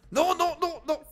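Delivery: high-pitched alarmed nos; panicked no. Real, not acted. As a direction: blurt it out in a panic. Panicked refusal at the pump.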